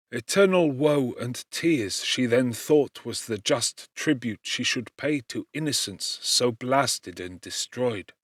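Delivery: ordinary delivery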